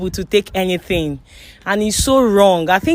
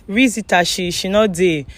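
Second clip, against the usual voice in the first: normal talking voice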